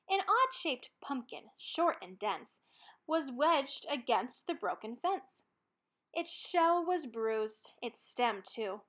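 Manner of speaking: ordinary delivery, nothing marked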